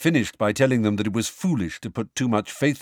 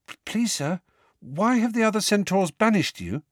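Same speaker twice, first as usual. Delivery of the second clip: put-on higher, timid girl's voice, stammered start; Parvati's nervous question to the centaur, pitched up; hesitant schoolgirl; 10 semitones higher than usual. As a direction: ask shyly, voice pitched up as a schoolgirl. Timid student asks banished centaur.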